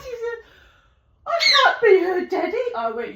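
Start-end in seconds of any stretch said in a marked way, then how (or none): 1.3-2.7 high, loud imitated protest; mock alarmed protest. Neighbour refusing the cat, high mock-panicked protest.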